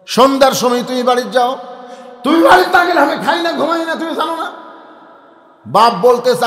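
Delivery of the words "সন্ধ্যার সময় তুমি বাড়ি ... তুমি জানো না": put-on pleading child. Child pleads at jail door.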